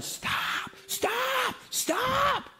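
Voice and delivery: high-pitched, strained mock yell, held back in volume; drawn-out falsetto imitation of yelling; imitated yelling. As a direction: cry out like a frantic woman far down the street. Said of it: "she's yelling".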